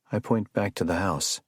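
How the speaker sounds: usual speaking voice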